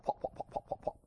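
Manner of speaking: soft, rhythmic chopper noise; helicopter imitation. Boss hears a chopper, soft rotor sound imitated. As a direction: imitate rotor blades with soft, repeated beats. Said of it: "Sounds like a chopper".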